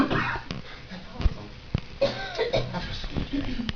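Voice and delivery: mock a lukewarm believer; half-hearted. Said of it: "Or was you just like".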